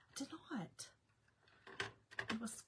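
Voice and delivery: whispered denial. Real, not acted. Denying giving him the shirt.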